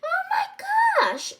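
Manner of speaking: very high, loud squeal; high-pitched gasp of joy; delighted squeal. A narrator's reading. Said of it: "They both squealed with delight".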